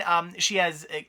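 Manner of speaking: ordinary talk, nothing marked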